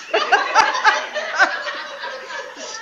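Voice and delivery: high, loud breathy laughter. Real, not acted. Laughing after the husband says he's really hurt. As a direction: laugh hard at the punchline.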